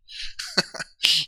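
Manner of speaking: amused little laugh; chuckle. Real, not acted. Amused while chatting on stream.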